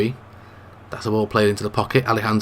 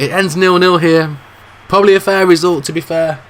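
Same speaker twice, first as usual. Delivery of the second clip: emphatic call; 5 semitones higher, 5 dB louder than usual. Acted. Final whistle called out.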